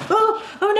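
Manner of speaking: high, startled little oh-oh; real dismay. Real, not acted. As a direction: let out a high yelp of alarm at a fumble. Fumbles tiny stitch markers.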